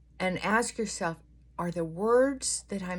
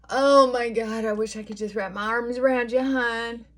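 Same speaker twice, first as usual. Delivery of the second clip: louder, higher burst of affection; emotional gush; 5 semitones higher, 7 dB louder than usual. Real, not acted. Moved by a viewer's sharing, a loud affectionate gush. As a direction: gush with love, quickly.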